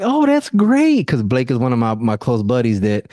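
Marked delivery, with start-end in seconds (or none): 0.0-1.1 much higher, slow sarcastic oh that's great; wife feigning delight, sarcastic; mock cheerful. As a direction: fake enthusiasm, dragged out. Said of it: "And she's like".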